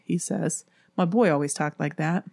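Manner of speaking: plain reading voice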